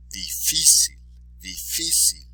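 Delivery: Difícil with "fí" stressed; stress on accented syllable. Shown as a demonstration. Teacher modelling for repetition, Difícil stressed on "fí". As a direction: lean on the middle of Difícil. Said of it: "be sure to emphasize the accented syllable".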